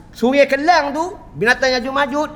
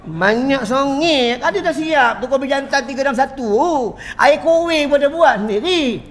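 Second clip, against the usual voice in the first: ordinary delivery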